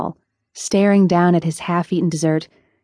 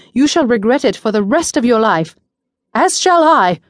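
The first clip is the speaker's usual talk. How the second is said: rising warning; 4 semitones higher, 4 dB louder than usual. A narrator's reading. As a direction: voice climbing higher and louder to the end. Ari's warning climaxing.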